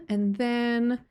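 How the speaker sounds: usual speaking voice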